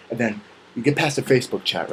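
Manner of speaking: ordinary talk, nothing marked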